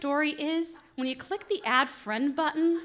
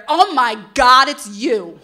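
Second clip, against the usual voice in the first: excited; 12 dB louder, much slower than usual. Retold or imitated. Moreno recognizes her online.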